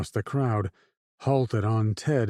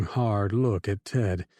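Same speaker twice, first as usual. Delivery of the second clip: ordinary delivery, nothing marked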